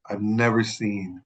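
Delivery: ordinary delivery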